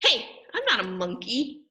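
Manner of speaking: protesting, a little outraged; indignant protest. Acted. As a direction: object as if tricked into saying it. Reader protests the forced words.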